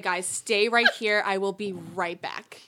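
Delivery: measured instruction. Retold or imitated. Fake guide telling tourists to wait, slow and deliberate. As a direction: spell it out slowly for the group.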